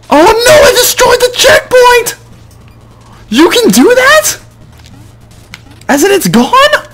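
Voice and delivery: panicked squeal. Real, not acted. Gamer realizing he broke the checkpoint, squealing in panic. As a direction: shriek it way up in disbelief.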